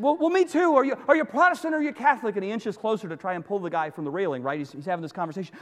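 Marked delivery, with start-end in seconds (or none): 0.0-2.4 high, fast, excited questioning; keyed-up rescuer rattling out questions; eager agreement. Acted. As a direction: pounce on the common ground. Rescuer finds common ground eagerly.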